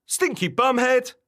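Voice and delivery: high, loud copycat taunt; shouted insult. Acted. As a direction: fling the insult straight back. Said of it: "shouted the wobbler".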